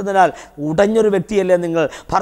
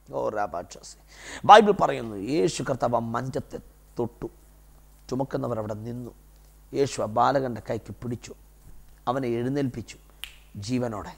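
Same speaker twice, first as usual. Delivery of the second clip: low, soft scripture telling; hushed preaching; 7 semitones lower, 9 dB quieter than usual. Real, not acted. Preacher recounting Jesus raising the boy, hushed. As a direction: slip into a reverent hush, phrase by phrase.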